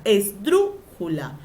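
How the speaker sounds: "esdrújula" slowed, with DRU punched; stressed syllable shown. Shown as a demonstration. Teacher showing students where stress falls, drawn-out "es-DRÚ-jula". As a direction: hit DRU in "esdrújula" and stretch the word out.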